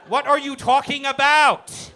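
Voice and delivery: ordinary delivery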